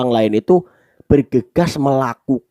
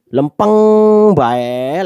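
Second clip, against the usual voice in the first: "lempeng" drawn out on one held note; monotone, straight; 6 semitones higher, 5 dB louder, much slower than usual. Shown as a demonstration. Teacher mocks undramatic speech, long straight "lempeng". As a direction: stretch "lempeng" out, then "bae". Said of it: "Yang dramatis lah intinya, jangan".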